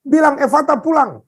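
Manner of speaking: high, urgent angry command; retold angry orders. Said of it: "saya marah".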